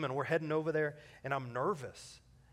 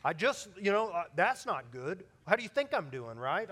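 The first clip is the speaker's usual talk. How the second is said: higher, louder playful voicing of a clumsy greeting; mock awkward exchange; 4 semitones higher, 6 dB louder than usual. Preacher mocking a clumsy greeting at a funeral, louder and higher. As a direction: act out the awkward chat. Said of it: "we're like".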